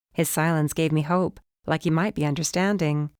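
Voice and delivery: ordinary delivery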